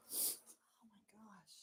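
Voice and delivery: slow hushed whisper. Real, not acted. Vince is asleep, so a slow whisper. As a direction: whisper so the sleeper stays asleep.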